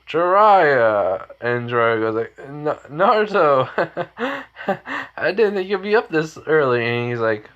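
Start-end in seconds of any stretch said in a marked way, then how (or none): 0.1-1.3 louder, higher call of a name. Acted. Calls out to drunk Jiraiya. 2.5-6.8 put-on high, laughing drunk voice; drunk man. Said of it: "basically he's drunk".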